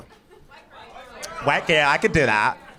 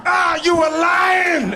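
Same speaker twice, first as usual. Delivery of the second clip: very high, drawn-out wail of accusation; mock outraged scream; 14 semitones higher, 4 dB louder, much slower than usual. Date who sniffed the fake cocaine, hammed-up high scream. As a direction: imitate her shrieking it out slowly. Punchline, date discovers the prank.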